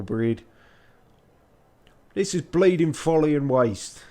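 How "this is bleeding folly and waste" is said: higher, louder attempt at Yorkshire; grumbled Yorkshire accent. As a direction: grumble it in broad mock Yorkshire. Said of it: "the artificer grumbled in a thick".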